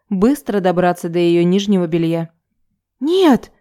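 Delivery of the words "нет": high-pitched squeaked protest. A narrator's reading. Woman trying to pull free, a high squeak. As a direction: squeak it out, much higher. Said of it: "пискнула она".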